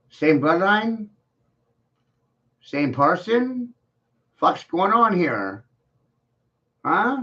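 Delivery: high, incredulous questions, slow at first; rising mock-shocked questions; mock disbelief. Real, not acted. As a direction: act stunned by the comparison.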